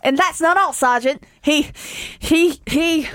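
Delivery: acted winded soldier, halting; out of breath. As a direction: stammer it out as if short of breath. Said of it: "they're out of breath".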